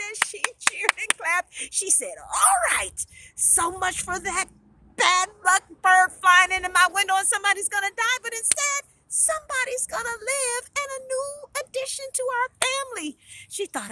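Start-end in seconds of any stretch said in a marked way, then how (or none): 2.2-13.2 high, loud triumphant cheer; jubilant. A narrator's reading. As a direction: brush off the bad omen in elation. Heroine celebrates brother's baby news.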